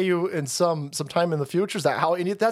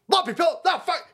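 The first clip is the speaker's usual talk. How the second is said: mock furious outburst; 12 semitones higher than usual. Retelling Lindell's outburst.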